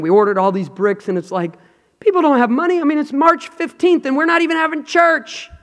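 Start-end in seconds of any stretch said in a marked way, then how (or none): 2.0-5.5 high fretful voice of worried members; high, anxious congregant complaint; worried church members. Retold or imitated. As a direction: voice the anxious talk, pitched up.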